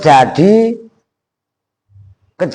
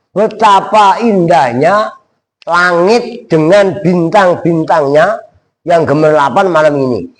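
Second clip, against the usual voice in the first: ordinary delivery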